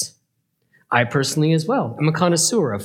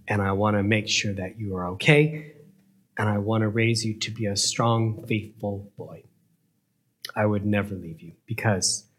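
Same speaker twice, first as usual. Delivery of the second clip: softer, lower, gentle reassurance; tender mother; 6 semitones lower, 6 dB quieter than usual. Acted. Mother promising her son she'll never leave, tender. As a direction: soothe him like a loving mom.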